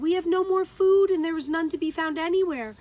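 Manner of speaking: high, loud and fast pleading; starving friends begging Geraldine for cheese, high and loud; desperate plea. A narrator's reading. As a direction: demand help in desperation. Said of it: "They were desperate".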